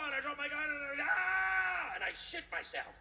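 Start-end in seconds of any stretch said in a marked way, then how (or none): 0.6-2.0 drawn-out high mock scream of terror. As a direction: let out a slow, hammed-up shriek. Terrified scream in the story.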